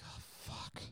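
breathy whispered oh fuck; whispered dread. Retold or imitated. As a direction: whisper it as sickness hits. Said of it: "I'm like".

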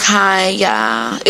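lightly put-on meeting-a-new-guy voice; a hint of a put-on first-meeting hello. Woman greeting a guy she just met, lightly put-on voice. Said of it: "I just met a new guy voice on".

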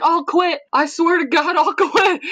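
high-pitched fed-up mock whine, played for laughs; Kevin from The Office. Retelling Kevin's sore-feet scene to co-host, high comic exasperation. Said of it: "Kevin's, like, sitting there, like, massaging his feet".

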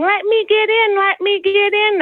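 put-on high whiny little-kid voice, begging; pleading toddler. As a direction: beg like a toddler at the pool gate. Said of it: "the little toddler coming over and going".

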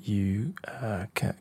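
ordinary talk, nothing marked

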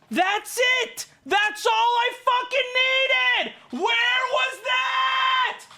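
high, drawn-out hollering; exultant shout. Retold or imitated. Customer handed free coupons, over-the-top exultant shout. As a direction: holler it high, stretching every word. Acts out his elated relief at coupons.

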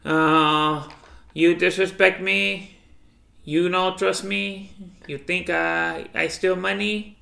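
slow, plaintive mock Filipino accent; imitated Filipino accent. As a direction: draw out each grievance in her Filipino accent. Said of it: "That's what she talks to".

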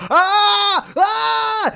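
very high, loud cartoon "ah"; short, piercingly high mock wails; mock cartoon scream. Imagined cut to SpongeBob screaming.

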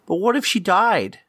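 put-on high child voice; worried little kid. Child asking mom about missing friend, high worried voice.

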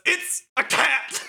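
slow, high, laughing through the words. Acted. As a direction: giggle it out slowly. Voicing Shoka, laughing.

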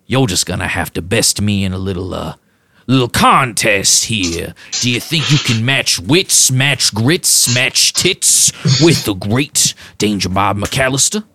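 lightly voiced boastful strongman; Danger Bob. GM as Bob challenging the player, mildly boastful. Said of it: "and goes".

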